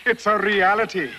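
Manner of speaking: put-on rally them with boyish glee; excited insisting. Explorer shows off the map to Julio and men, excited.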